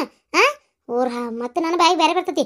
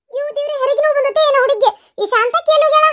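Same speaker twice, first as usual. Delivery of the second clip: high, fast, frightened rush of words; woman panicking as labour pains begin; panicked; 7 semitones higher, faster than usual. Acted. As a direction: blurt it out in a panic.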